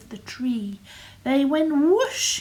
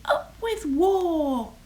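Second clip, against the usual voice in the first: high, slow rallying cry; drawn-out slogan, pitched high; shouted cheer; 6 semitones higher, slower than usual. A narrator's reading. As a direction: stretch out a high storybook shout. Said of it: "And they shouted".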